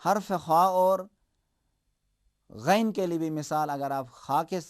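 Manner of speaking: normal talking voice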